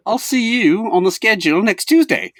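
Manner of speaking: high, loud mock British accent. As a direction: put on a posh-sounding British voice for the joke. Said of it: "Good accent".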